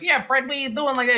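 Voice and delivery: a hint of the cartoon buddy; Barney voice. Imitating Barney's first movie voice to a friend. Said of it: "talking like the very first".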